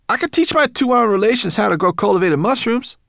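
high, loud, eager little creature; piping creature voice. A narrator's reading. Creature offering mushroom lessons to relations, piping high.

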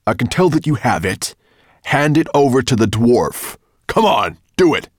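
commanding dragon. Acted. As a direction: push harder with every word. Dragon demands the scale.

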